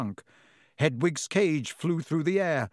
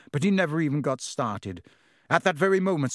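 plain reading voice

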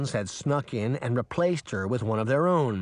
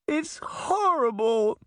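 tearful; 10 semitones higher, slower than usual. A narrator's reading. Crying daughter answering mother.

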